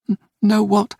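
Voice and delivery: put-on high, stammering frightened child; a scared boy stumbling on the words; trembling whisper. A narrator's reading. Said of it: "he whispered, his voice trembling".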